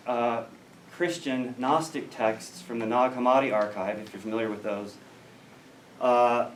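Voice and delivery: ordinary talk, nothing marked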